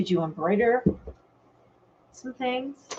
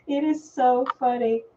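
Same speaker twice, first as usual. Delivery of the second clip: ordinary delivery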